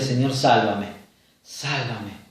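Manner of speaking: fading plea. Acted. Peter sinking, crying for help.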